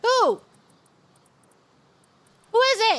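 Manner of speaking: sharp, high, loud question; loud demand. Real, not acted. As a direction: demand to know who, loudly. Demanding the name aloud.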